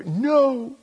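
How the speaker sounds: put-on high, defiant little-kid no; stubborn toddler. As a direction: refuse like a three-year-old. Toddler refusing help.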